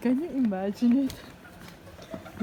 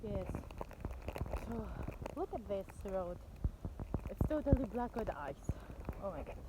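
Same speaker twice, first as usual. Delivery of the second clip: hushed; 3 semitones lower, 16 dB quieter than usual. Real, not acted. Filming the icy road quietly.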